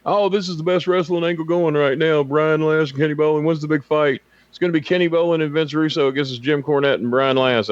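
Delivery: high-pitched mock wrestling fan; imitated fan voice. Imitating fans hyping a wrestling feud, high mock voice. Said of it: "if he imitates somebody, he's doing their voice".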